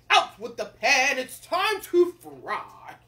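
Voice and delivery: loud, high, stretched-out order; commanding king. Acted. King tells the cook the next job, commanding. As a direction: proclaim it slowly and grandly.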